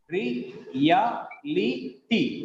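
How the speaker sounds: very slow "reality", re, A, li, ty; syllable by syllable. Shown as a demonstration. Teacher counting syllables, "reality" in four separate pieces. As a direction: break "reality" into four beats. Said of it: "We have four syllables here".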